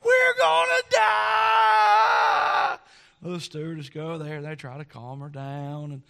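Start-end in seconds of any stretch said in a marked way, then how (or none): 0.0-2.8 very high, drawn-out screaming wail; child's scream. Retold or imitated. Little girl shrieking on the bumpy plane, long high wail. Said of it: "the louder this girl screamed".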